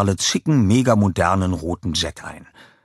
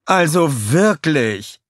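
high, loud, slow exasperated villainess; a high, loud, slow, put-out sigh of a call; Scarlett calling; 8 semitones higher, 5 dB louder, much slower than usual. A narrator's reading. Scarlett scolds the beaten villains, high and loud. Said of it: "rief sie".

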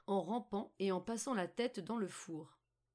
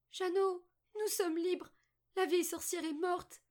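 put-on very high, raised girl's shout; joyful cry; 10 semitones higher, 4 dB louder than usual. A narrator's reading. Margot freeing her brother, high excited cry. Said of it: "lui ouvrit l'étable et lui cria".

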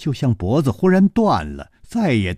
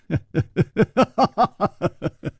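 high, amused laugh falling away; 9 semitones higher than usual. Acted. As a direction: chuckle at her stammering. 楚留香含笑追问. (楚留香含笑逼问，轻声一笑)

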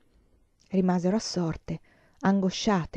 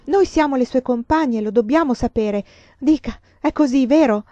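higher, louder pleading; urgent insistence; 5 semitones higher, 7 dB louder than usual. Acted. Sobbing girl begs the nun for the truth, loud. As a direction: press her for the truth.

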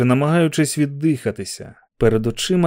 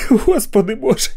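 laughing cry; 10 semitones higher, 3 dB louder than usual. Acted. Repeated exclamation.

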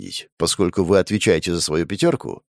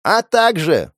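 shouting; 8 semitones higher, 4 dB louder, slower than usual. Acted. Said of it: "прокричал Жуков".

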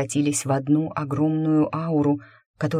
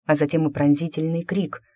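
plain reading voice

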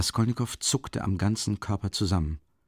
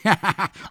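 short, higher amused laugh; 9 semitones higher than usual. Acted. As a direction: laugh him off lightly. Investigator laughs mid-argument.

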